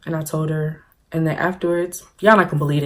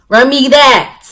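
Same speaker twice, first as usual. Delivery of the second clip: loud, high, forceful demand; punchy, raised-voice comic demand; mock demand; 8 semitones higher, 8 dB louder, slower than usual. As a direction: belt it out as a playful order.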